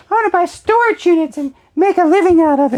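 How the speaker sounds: high-pitched, loud put-on voice; hammed-up announcement of a big dream; mock schoolkid declaration. Student telling teacher about storage units, hammed-up high voice. Acts out himself announcing career plan.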